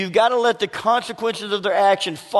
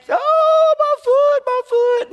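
put-on much higher and louder child's wail; crying child; 5 dB louder, much slower than usual. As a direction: wail like a little girl with a splinter in her foot.

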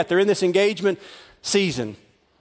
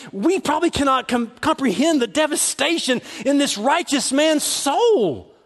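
usual speaking voice

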